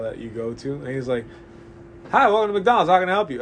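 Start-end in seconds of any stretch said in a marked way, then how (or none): 2.1-3.4 loud, high-pitched rattled-off greeting; auctioneer. Retold or imitated. McDonald's worker greets a customer like an auctioneer. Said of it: "fucking auctioning nuggets".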